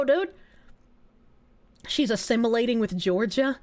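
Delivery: normal talking voice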